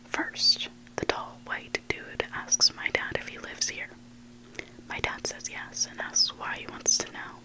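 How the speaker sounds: usual speaking voice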